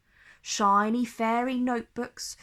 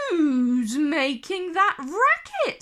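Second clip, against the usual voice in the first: higher, louder, slow owl screech; grumpy owl screeches; 5 semitones higher, 4 dB louder, slower than usual. A narrator's reading. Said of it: "screeched the owl".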